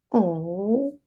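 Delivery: usual speaking voice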